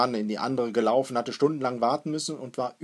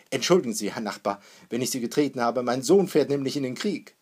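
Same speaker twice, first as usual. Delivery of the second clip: hearty, raised-voice excuse; loud proud apology; 2 semitones higher, 8 dB louder than usual. A narrator's reading. Father excuses himself to a stranger, loudly proud. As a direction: call it out over the crowd. Father jostles through the crowd.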